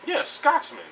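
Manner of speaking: high-pitched, hammed-up "Yeah, Scotsman."; high mock Scotsman. Shown as a demonstration.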